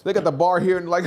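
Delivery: ordinary delivery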